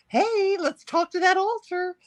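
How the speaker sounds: mock chirpy therapist.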